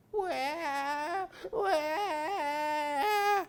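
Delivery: high sobbing mock cry; wailing tree. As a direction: blubber like a sad little tree. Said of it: "wailed the tree".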